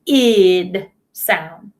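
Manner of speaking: "id" with a clear short i before the d; the ED ending sounded out as "id". Shown as a demonstration. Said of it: "That first sound that we practiced".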